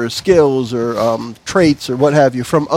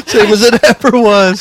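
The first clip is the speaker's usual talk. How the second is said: louder laughing echo; 5 semitones higher, 6 dB louder than usual. Real, not acted.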